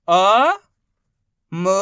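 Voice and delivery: loud, held अ, then a separate म; drawn-out letter drill on अ and म; sounding out letters. Shown as a demonstration. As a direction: stretch अ and म one at a time. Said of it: "एक ही अक्षर का उच्चारण करके".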